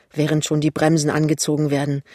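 ordinary delivery, nothing marked

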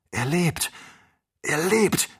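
joyful cry; excited cry. A narrator's reading. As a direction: exclaim the good news, building up. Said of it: "rief Ferguson".

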